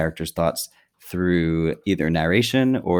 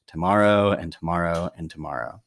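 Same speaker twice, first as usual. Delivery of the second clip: "tomorrow" repeated in a dull, dragging, monotonous tone; weary monotone. Shown as a demonstration. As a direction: read it like each day is the same. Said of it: "The, the way that Macbeth sees the days is the way that, uh, Shakespeare starts the speech".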